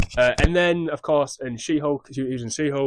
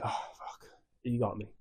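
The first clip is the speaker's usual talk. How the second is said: breathy whispered admission of defeat; 10 dB quieter than usual. Retold or imitated. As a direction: whisper it like a beaten villain. Villain admits defeat, whispered.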